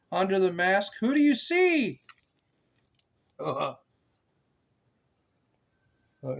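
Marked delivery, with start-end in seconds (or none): 0.1-2.0 rising, questioning refrain; sing-song refrain. A narrator's reading. Asking the child the book's refrain, sing-song.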